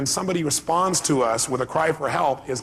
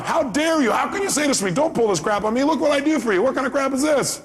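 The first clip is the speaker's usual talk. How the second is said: high, raised indignant outburst; mock angry retort; 9 semitones higher than usual. Speaker acting his angry reply to his mom, high indignant. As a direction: snap back at her, high and hurt. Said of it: "I respond with a cry for help".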